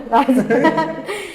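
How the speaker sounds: bright burst of laughter; real laugh. Real, not acted. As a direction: laugh at the dark joke. Joke about the pills.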